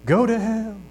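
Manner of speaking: high-pitched yell back, no real volume; mock holler from across the yard; imitated heckler's holler. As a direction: call it out high, like a distant heckler. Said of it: "that guy hollered at you".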